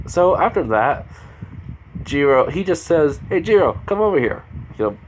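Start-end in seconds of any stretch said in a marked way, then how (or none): 3.3-4.4 higher, louder call across to someone. Acted. Boy calls Jiro back over to the group, calling out. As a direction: call her over, pitched up and projected.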